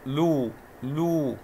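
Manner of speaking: لو held very slowly as "luu, luu"; long vowel. Shown as a demonstration. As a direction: draw out the u of لو. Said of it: "ويوجد مد بالواو".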